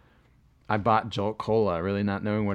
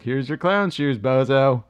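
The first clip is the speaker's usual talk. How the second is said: mocking clerk; 4 semitones higher, 6 dB louder, slower than usual. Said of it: "and they're like".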